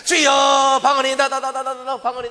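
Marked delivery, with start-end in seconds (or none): 0.0-0.8 loud tearful cry. Acted. Preacher crying out to the Lord, loud tearful cry.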